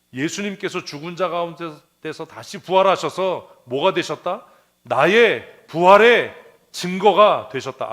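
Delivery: slow, loud proclamation of the paraphrase; slow ringing declaration, 부활의 drawn out; preached paraphrase. Shown as a demonstration. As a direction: declare it in your own words, building loud. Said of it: "이건 제 표현이에요".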